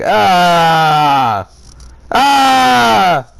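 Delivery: shouting out in disgust at the story; disgusted yell. Real, not acted. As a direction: recoil with a loud yell of disgust. Reacting to creepy story line.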